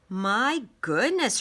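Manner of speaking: mother pretending surprise at the quarter; exclaiming. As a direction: stretch it out in playful astonishment. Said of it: "her mother exclaimed".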